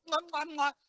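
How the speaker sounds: besuqueo juguetón; besos sonoros. Pretend. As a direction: besos ruidosos al hijo. Besos al hijo. (besos sonoros y alegres)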